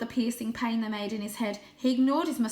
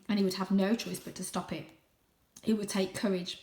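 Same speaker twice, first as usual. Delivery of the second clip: usual speaking voice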